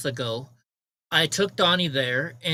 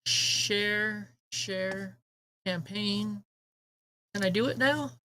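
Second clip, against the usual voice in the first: soft, higher, absent-minded mutter; muttering to oneself; 4 semitones higher, 7 dB quieter, much slower than usual. Real, not acted. Streamer tries to share a campaign, real quiet muttering. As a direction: talk to yourself while hunting through controls.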